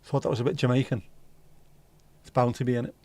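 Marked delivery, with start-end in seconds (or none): none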